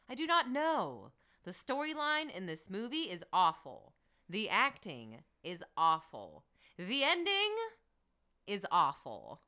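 stiff, over-enunciated reading aloud; mock-earnest declarative recital; nephew's review. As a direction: read each word deliberately, like a kid's indignant review. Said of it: "the kind of review my nephew might have written".

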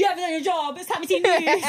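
high, loud announcer voice; hammed-up news intro voice; mock announcer. Imitating Tammy's news intro.

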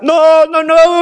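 high, loud panicked no, no, no; imitated toddler scream. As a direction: scream it like a frightened little girl. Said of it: "comenzaba a gritar".

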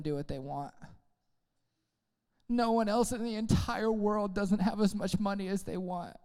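soft, slow, put-on lament; mock self-pity. Believer lamenting a struggle nobody shares, mock self-pity. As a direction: lightly play the lonely sufferer.

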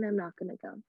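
normal talking voice

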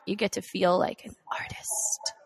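secret little aside; whispering. Acted.

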